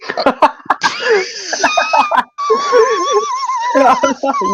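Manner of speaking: high squealing burst of laughter; shrieking laugh. Real, not acted. Hysterical laugh at joke.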